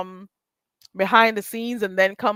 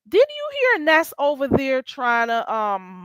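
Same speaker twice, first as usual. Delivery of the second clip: ordinary delivery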